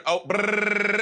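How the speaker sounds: buzzing lips like tires on rumble strips; rumble strip noise. Retold or imitated. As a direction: mimic a rumble strip vibration.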